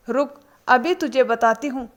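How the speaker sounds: plain reading voice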